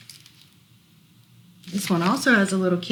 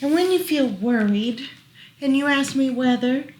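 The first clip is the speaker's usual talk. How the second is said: lilting rhyming read-aloud; reading to children; 4 semitones higher than usual. Acted. Parent reading a rhyming book to child, gentle lilt. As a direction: read the rhyme gently to a toddler.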